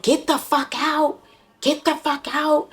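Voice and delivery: high-pitched imitation of an angry mother ordering him out; imitating the mother shouting at her son; retold mother yelling. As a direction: mimic her screeching get out. Mother throwing son's stuff out.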